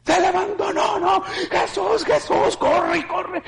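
high, frantic retold yelling; high panicked shouts; panicked cry. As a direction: cry out in alarm. Panicked cry of morgue workers.

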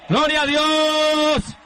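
fervent revival preaching voice; preaching at full force. Acted. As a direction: preach it like a revival altar call. Preacher exhorting congregation.

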